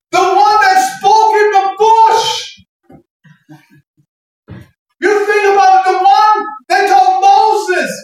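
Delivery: high, stretched-out preacher's cry; full-force preaching. Acted. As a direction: build into a ringing, half-sung proclamation.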